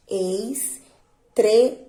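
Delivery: very slow "Estrela", long pauses between syllables; syllables pulled apart. Shown as a demonstration. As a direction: break "Estrela" into three separate syllables. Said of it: "Qual é a sílaba mais forte".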